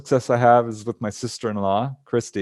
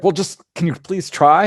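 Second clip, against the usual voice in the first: a little angry; 6 semitones higher, 4 dB louder than usual. Retold or imitated. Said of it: "I started to get a little angry".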